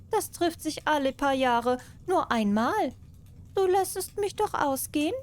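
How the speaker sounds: butter the mouse up as the cat. Acted. Cat asks again, high voice.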